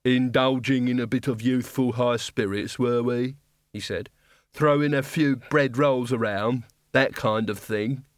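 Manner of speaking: a condescending guard looking down on students; gruff guard captain. Acted. Guard captain mocking the wizards' wreckage as student pranks.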